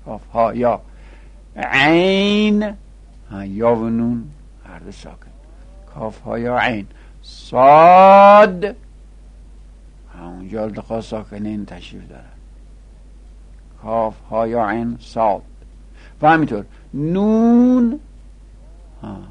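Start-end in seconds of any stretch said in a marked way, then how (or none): 1.6-2.7 عین held very long on a high note; prolonged recitation. Shown as a demonstration. Teacher reciting a Quran letter, prolonged recitation. 7.2-8.7 صاد held very long, loud and rising; prolonged recitation. Shown as a demonstration. Teacher reciting a Quran letter, prolonged recitation. 16.9-18.0 نون held long, climbing high; prolonged recitation. Shown as a demonstration. Teacher adding another Quran letter, prolonged recitation.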